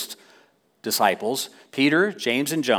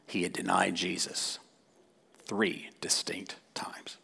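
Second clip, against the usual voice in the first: hushed; 6 semitones lower, 14 dB quieter than usual. Real, not acted. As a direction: drop to a grave whisper.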